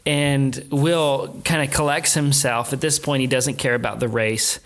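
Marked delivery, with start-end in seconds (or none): none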